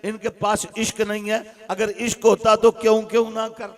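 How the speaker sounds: loud, driving sermon with call and answer; fiery preaching. Acted. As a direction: preach it hard to the crowd. Preaching at full force. (ज़ोरदार तक़रीर का अंदाज़)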